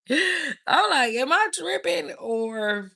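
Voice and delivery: loud, high, drawn-out laughing words; incredulous, cracking up at herself; laughing disbelief. Retold or imitated. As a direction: laugh through the retold question, stretching it out. Laughing while retelling her disbelief.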